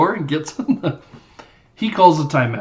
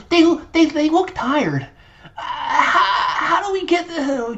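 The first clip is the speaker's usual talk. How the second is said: high, slow put-on coach voice with a laugh; mock Michelle McCrae; slower than usual. Podcaster mimics the fake coach, high and giggly. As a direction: play the fake coach, squeaky and unsure. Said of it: "You know".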